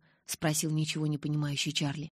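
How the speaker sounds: usual speaking voice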